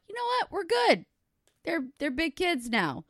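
high-pitched, carefree self-quote; mock breezy confidence. Mom deciding the kids are big now, mock-breezy and high. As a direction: imitate yourself blithely deciding all is fine. Mimics her overconfident thought before disaster.

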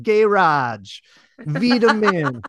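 "garage" drawn out slowly in American style; "vitamin" stretched out, the American way; American pronunciations. Shown as a demonstration. Comic repeating a show bit, exaggerated American pronunciation. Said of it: "say the American pronunciation".

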